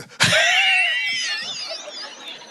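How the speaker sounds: very high airless wheeze; breathless big laugh. Shown as a demonstration. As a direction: imitate a laugh with no air left. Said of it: "I used to have no air".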